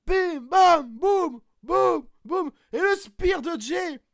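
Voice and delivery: play-by-play high and loud, going up; commentaire survolté. Acted. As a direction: commente le match comme en direct, à fond. Commentary of the match. (commentateur de catch surexcité)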